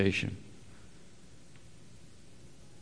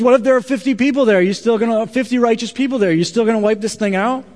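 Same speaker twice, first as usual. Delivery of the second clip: higher, louder pressing questions to God; challenging Abraham; 9 semitones higher, 6 dB louder than usual. Retold or imitated. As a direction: push God hard, mock bold challenger.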